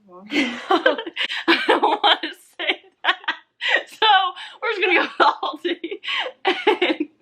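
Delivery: giggling through the words, pitch jumping up; laughing it off. Real, not acted. Reading a book blurb to her co-host, giggling. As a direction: laugh at your own stumble over the name.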